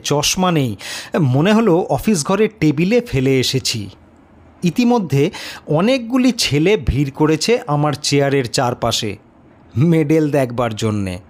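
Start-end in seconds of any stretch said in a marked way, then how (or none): none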